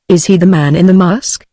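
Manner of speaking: ordinary delivery, nothing marked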